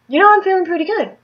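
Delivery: high, quick, chirpy self-assurance; upbeat retold thought.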